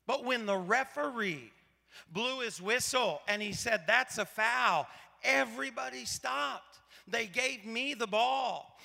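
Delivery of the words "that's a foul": slow, louder, firm official call; referee's call. Retold or imitated. Referee blows whistle and rules on the play, slow firm call. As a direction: state it with calm authority.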